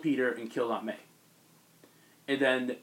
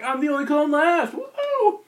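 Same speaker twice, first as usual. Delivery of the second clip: much higher, louder gleeful shout; hyped arrival; 14 semitones higher, 7 dB louder, slower than usual. Retold or imitated. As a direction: burst in bragging and cheering.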